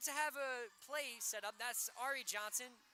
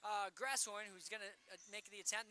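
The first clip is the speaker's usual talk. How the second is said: ordinary talk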